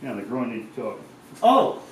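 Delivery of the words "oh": sharp, high, loud surprised yelp. Real, not acted. Startled mid-stretch while his friend hands him chalk, surprised. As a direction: caught off guard, blurt it. Sudden exclamation mid-workout.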